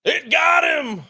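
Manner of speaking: high, loud, slow yell. Retold or imitated. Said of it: "Steve yelled".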